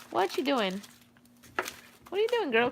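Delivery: high, soft sing-song question to a puppy. Real, not acted. Owner coos high and soft at her playful dog.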